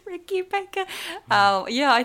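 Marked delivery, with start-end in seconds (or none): 0.1-0.8 "Ricky Baker" said high through laughter; savoring the name, laughing. Shown as a demonstration. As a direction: relish "Ricky Baker" with an amused lilt. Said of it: "Ricky Baker is such a funny name".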